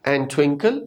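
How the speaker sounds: and twinkle as "an twinkle"; dropped D. Shown as a demonstration. Teacher showing the linking rule, silent D. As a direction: link and twinkle with no D in "and". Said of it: "का डी हम नहीं पढ़ेंगे".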